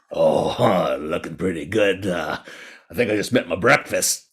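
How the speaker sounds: low storybook tiger voice; lazy, menacing big cat; hungry tiger. A narrator's reading. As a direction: size up your prey, low and unhurried. Tiger figure eyes the frog.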